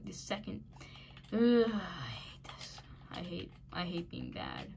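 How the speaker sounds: ordinary delivery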